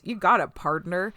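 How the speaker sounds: loud mock cowboy twang; Southern drawl. To friends, voicing Data in a Southern cowboy accent. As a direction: drawl it like a cowpoke. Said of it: "Data goes".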